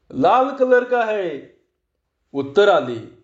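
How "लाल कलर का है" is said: high, drawn-out reply; boy's called answer. A narrator's reading. Boy under the sheet answering magician, calling back. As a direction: call back slowly in a high pitch.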